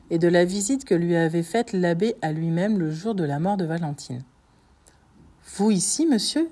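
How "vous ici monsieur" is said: higher-pitched startled question; taken aback, pitch jumping up. A narrator's reading. Villefort meets the abbé at his home, startled.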